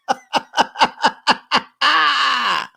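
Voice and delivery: high, loud delighted cackle; loud laugh. Real, not acted. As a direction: burst out laughing. Laughing at the dig.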